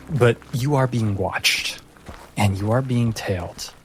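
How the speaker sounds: ordinary talk, nothing marked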